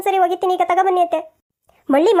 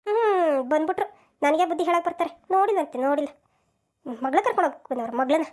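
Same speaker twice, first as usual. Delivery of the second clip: mother-in-law griping to herself after the in-law leaves; grumbling aside; 2 semitones lower, 6 dB quieter than usual. Acted. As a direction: mutter resentfully to yourself.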